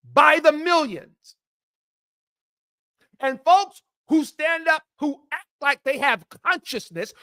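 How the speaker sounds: high, forceful, indignant oratory; full-force righteous rant; impassioned preaching. Real, not acted. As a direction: raise your voice and denounce them like a preacher. Impassioned political rant to the audience.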